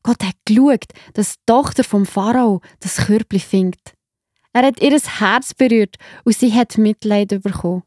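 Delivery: ordinary delivery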